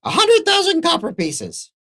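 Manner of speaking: very high squeaky creature voice; hesitate, then squeak out the price; small trader's high-pitched announcement; little merchant naming price. Acted. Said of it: "He goes".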